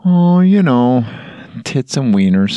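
to a grieving friend, in a silly exaggerated Fargo accent. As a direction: drag out the catchphrase in a mock Fargo lilt. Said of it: "That's the only thing you say in that accent".

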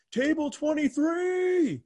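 high drawn-out shout across the room; retold shout. Stranger shouting a table number across the room. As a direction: call out the table number like an announcer. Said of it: "somebody screams".

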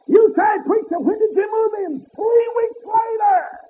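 high ringing preacher's cry; preaching at full force. Acted. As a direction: lift it up and ring it out to the congregation. Preacher whooping, shout.

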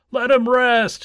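high, loud counter-cry; shouting. A narrator's reading. Others answer the tortoise's backers, shouting. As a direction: call it out over the crowd. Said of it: "shouted others".

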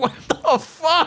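slow high mock what the fuck; slow drawn-out disbelief. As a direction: stretch it out in disbelief. Retold disbelief.

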